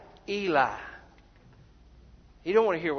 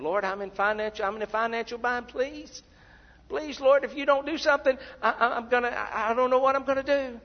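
high-pitched mock desperate prayer; imitated begging, stumbling over words; frantic pleading; 6 semitones higher than usual. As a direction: plead in a hammed-up panic, pitch climbing. Mimics frantic prayer over money.

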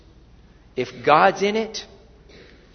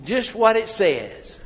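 ordinary delivery

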